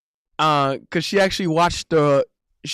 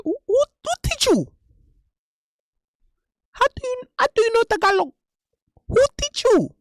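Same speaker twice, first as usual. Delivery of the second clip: mock Filipina accent. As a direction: squeak it like the surprised Filipina girl. Imitating the Filipina girl.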